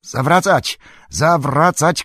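high, loud shouted command; Aragorn. A narrator's reading. Aragorn ordering the boats to turn back, shouting. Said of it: "krzyknął Aragorn".

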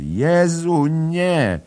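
high, loud shriek of alarm; screaming. A narrator's reading. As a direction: recoil with a scream. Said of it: "Bella cofa się i krzyczy".

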